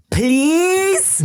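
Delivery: squeaky high please broken by a laugh; toddler's please. Retold or imitated. A father imitating his daughter demanding please, very high and laughing. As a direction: mimic a tiny girl correcting her dad. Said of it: "she looked at me and she went".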